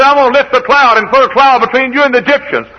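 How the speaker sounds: high, loud pulpit shout; God's proclamation, preached. Acted. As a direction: proclaim it at full force. Preacher voices God at full force.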